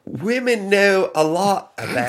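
high, slow mock English accent; fake English accent. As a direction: put on a phony Northern English accent. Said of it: "her fake, fake accent".

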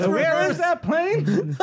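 high, drawn-out persona voice; Bobcat Goldthwait. Shown as a demonstration. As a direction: wail it slowly as a strangled comic character. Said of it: "It's the worst Bobcat".

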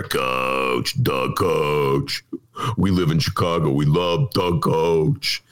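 low, slow Chicago fan chant; drawn-out low Chicago accent. Retold or imitated. Fans hail coach Ditka in low slow Chicago voice. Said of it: "Mike Ditka, former coach".

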